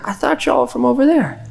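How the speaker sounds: very high, insistent Southern lady; Southern accent. Retold or imitated. Southern lady doubting Muslims are local, Southern accent. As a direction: mimic her high Southern twang. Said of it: "she said it three or four times".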